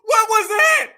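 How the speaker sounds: loud, high-pitched disbelief; incredulous outburst. Real, not acted. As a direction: demand to know what on earth that was. Baffled reaction to a garbled clip.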